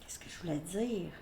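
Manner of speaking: breathy muttered question to herself; quiet unvoiced self-question; whispered aside. Real, not acted. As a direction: whisper it to yourself, lost. Loses thread, mutters.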